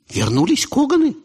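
high surprised question. Acted. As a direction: lift it in surprise.

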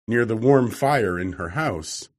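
ordinary delivery, nothing marked

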